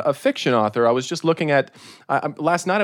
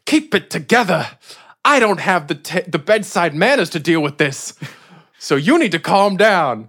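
stern sheriff; 3 semitones higher, 4 dB louder than usual. Acted. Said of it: "slaps her across the face and says".

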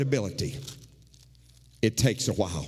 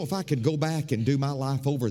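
ordinary delivery

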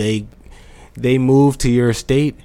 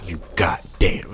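gruff movie insult; 5 semitones lower, 6 dB quieter, slower than usual. Shown as a demonstration. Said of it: "put the goddamn in front of you".

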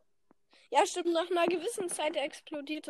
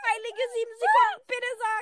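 excited shout; 6 semitones higher, 3 dB louder than usual. Real, not acted. Tense end of game round.

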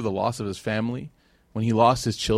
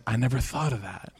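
quiet realization; 7 dB quieter than usual. Retold or imitated. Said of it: "she said".

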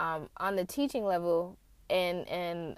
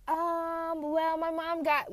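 hedging; 7 semitones higher, much slower than usual. Retold or imitated. Acts her evasive answer about name.

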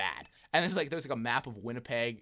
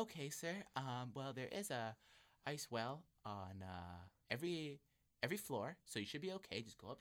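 polite concierge; 14 dB quieter than usual. Retold or imitated. A hint of the professional concierge, softly. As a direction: soothe him like tired hotel staff.

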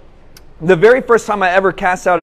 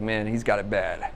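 hushed aside; 9 semitones lower, 12 dB quieter than usual. Retold or imitated. Aside about the crying boy.

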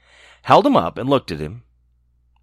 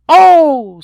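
gingerbread man voice; 11 dB louder than usual. Acted. Gingerbread man being eaten.

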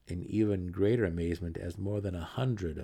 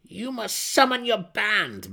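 much higher, loud, slow commanding cry; a desperate matron barking an order; 13 semitones higher, 9 dB louder than usual. Acted. Malice crying out to Jarlaxle for his band. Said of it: "Malice cried".

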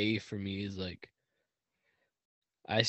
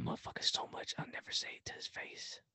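breathy whispered confession, voice dropped away; whispered aside; 14 dB quieter than usual. Real, not acted. To the podcast listeners about his friend, a hushed whisper. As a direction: whisper it like a secret he'd never admit.

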